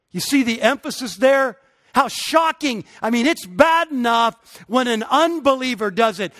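rising, emphatic sermon delivery; preaching. Acted. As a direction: preach it, building with conviction. Preacher builds to full force.

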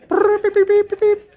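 loud, high-pitched rapid censor beeps; imitated bleeps. Preacher mimicking a bleeped TV tirade, loud high beeps. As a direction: squeal a burst of bleeps over the cussing. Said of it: "have to be bleeped out".

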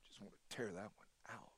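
soft, voiceless conspiratorial whisper; mock whisper. Preacher voicing a believer who dislikes a verse, whispered aside. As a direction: confess it under your breath.

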